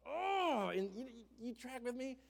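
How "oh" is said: high, loud strangled oh of frustration; frustrated groan. Retold or imitated. Preacher reliving the tailgate crash, strangled frustrated oh. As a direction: let the pent-up anger squeeze out.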